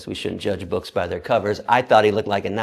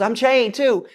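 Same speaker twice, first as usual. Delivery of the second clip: very high, loud answering call; excited high mock yell; yelled reply; 13 semitones higher, 6 dB louder, slower than usual. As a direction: imitate a friend shouting back, pitched way up. Friend yells back her answer.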